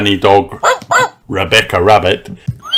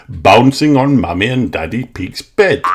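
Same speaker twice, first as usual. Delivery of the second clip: ordinary delivery, nothing marked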